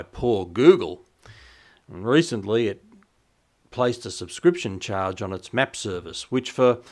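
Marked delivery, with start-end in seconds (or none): 0.0-1.0 slow, louder, hammed-up sympathy; mock pity. Teasing Google over its new map charges, mock pity. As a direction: fake a sad sigh for a rich company. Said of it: "and so we can all cry".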